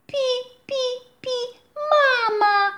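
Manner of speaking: very high, slow happy chirping; joyful chick cry. A narrator's reading. Chick calling the rabbit mama, high joyful peeps. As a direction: sing it out like a delighted chick. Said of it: "krzyknęła radośnie żółta kuleczka".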